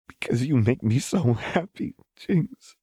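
happy words breaking into a small sob. A narrator's reading. Lux confessing her joy to Jinx, near tears. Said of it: "Lux said with a small sob".

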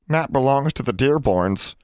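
high, plaintive elf moan; moaning house elf. A narrator's reading. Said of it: "he moaned".

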